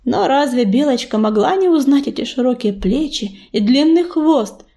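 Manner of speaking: plain reading voice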